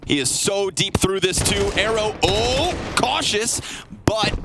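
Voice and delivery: building, rising caster call; excited play-by-play. Acted. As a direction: ramp up the call as action heats. Live esports play-by-play.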